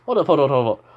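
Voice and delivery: loud, rapid-fire repeated protest; acted startled outburst; urgent interruption. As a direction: cut in loudly to halt everything. Character interrupts Tony in surprise.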